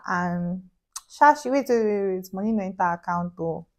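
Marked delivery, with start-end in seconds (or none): none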